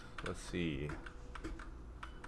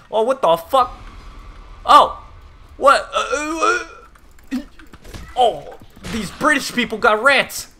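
high, loud shocked yelps at a jump scare; real fright, shriek and stammer; startled yelp; 13 semitones higher, 11 dB louder than usual. Real, not acted. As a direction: caught off guard, squealing in real shock.

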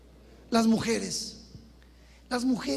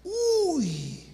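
a short high leering yelp; mock leering reaction; 6 semitones higher than usual. Boys on the corner gawking at a girl, high mock yelp. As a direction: mimic a guy gawking at a girl.

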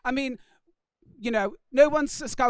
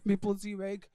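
ordinary delivery

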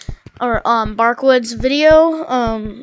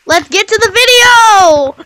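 slow, loud and high call; hyped announcement; 5 semitones higher, 5 dB louder, slower than usual. Real, not acted. Announcing video start.

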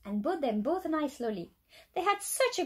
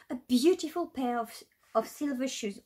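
plain reading voice

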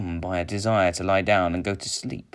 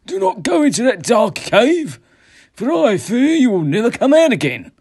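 high, loud, fearful warning; alarmed companion pleading at full voice; urgent warning; 11 dB louder than usual. A narrator's reading. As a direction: cry out to hold him back. Companion warns him off the cave.

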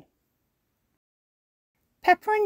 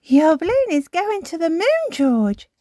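put-on high little-girl voice; Peppa warning; 12 semitones higher, 7 dB louder than usual. A narrator's reading. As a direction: tell your brother the news. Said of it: "says Peppa".

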